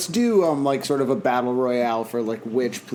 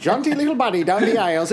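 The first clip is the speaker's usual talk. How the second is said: higher-pitched, hammed-up Newfie voice; Newfoundland accent; 7 semitones higher than usual. Radio personality on a plane, in hammed-up Newfoundland accent. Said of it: "hails from the Rock".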